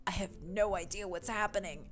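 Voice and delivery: hushed confession of being lost; leaning-in aside. Acted. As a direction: confide it quietly to a friend. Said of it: "[name] leans in".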